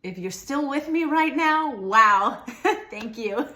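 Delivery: real delighted exclamation, with a laugh; excited. Real, not acted. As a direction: burst out with surprised gratitude. Surprised thanks to viewers.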